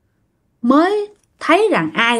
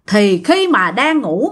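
plain reading voice

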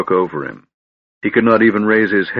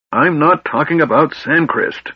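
mild snarl, pitched high; irritated dwarf, voice raised in pitch; 8 semitones higher than usual. A narrator's reading. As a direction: snap back with exasperation. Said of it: "Flint snarled".

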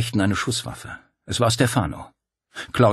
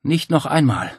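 higher, louder refusal; defiant; 7 semitones higher, 4 dB louder than usual. A narrator's reading. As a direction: slam the door on it. Claudia defiant over the noise.

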